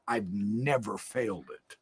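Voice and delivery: whispered aside. Real, not acted. To the listener, a confiding whisper. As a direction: drop to a whisper.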